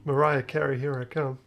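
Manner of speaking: ordinary delivery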